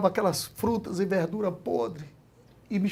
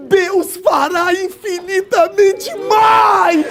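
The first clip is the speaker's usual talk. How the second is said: very slow, much higher and louder shout; preached shout; 11 semitones higher, 13 dB louder, much slower than usual. Acted. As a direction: roar the promise to the congregation. Sermon climax shout.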